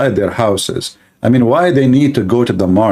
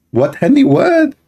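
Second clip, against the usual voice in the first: squeaky high disbelieving what; wife baffled by husband. Acted. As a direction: jump up in confusion.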